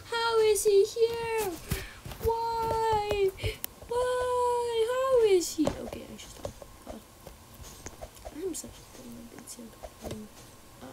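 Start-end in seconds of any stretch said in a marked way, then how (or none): 0.1-5.6 much higher and louder, near crying; shrieking disbelief. Real, not acted. Cousin keeps following, high louder wailing disbelief. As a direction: wail it in panic.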